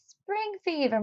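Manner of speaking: put-on high, dismissive old neighbor; old lady voice. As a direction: scoff lightly like an old lady. Missus Seed Nibbler voiced.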